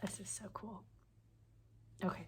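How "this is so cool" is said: whispered in quiet awe, much softer; awed whisper. Real, not acted. Unboxing a gift for viewers, hushed awed whisper. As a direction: breathe it out like a secret delight.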